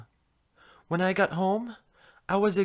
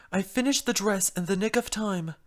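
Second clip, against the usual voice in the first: usual speaking voice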